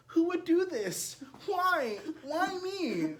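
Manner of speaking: very high, loud, slow wail; mocking the truck owner's wail over his tires; mock whine.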